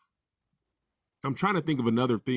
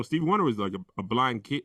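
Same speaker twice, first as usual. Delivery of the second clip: ordinary talk, nothing marked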